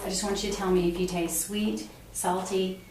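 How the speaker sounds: usual speaking voice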